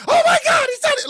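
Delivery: very high, loud exclamation; high-pitched yelled outburst; acted shriek. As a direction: shriek it out in mock shock. Acted shriek of crowd reaction.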